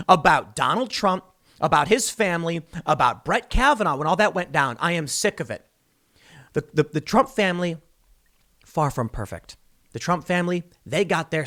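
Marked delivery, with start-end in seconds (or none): none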